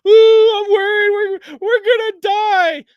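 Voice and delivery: high, whiny, slow mocking wail; mock crying coward. Mocking a panicking soldier from his platoon with a high whiny wail. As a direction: whimper it like a scared little soldier.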